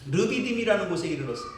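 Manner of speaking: usual speaking voice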